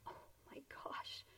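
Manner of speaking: breathless whisper of amazement; whispered awe. Retold or imitated. Storyteller reacting to a stranger singing, whispered awe. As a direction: breathe it out in disbelief. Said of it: "And I'm like".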